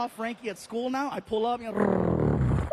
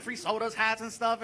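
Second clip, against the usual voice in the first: normal talking voice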